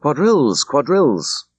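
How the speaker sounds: put-on red-faced young woman calls out the dance, excited; excited crying out. A narrator's reading. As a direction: announce the dance with eager delight. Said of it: "excitedly clapping her hands and crying".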